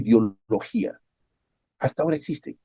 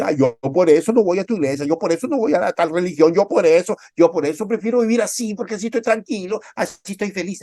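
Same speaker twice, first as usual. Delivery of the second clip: high, loud self-satisfied whine; a mock unbeliever bragging he is happy without God; smug unbeliever; 7 semitones higher, 4 dB louder than usual. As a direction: gloat about staying away from church. Smug unbeliever justifying himself.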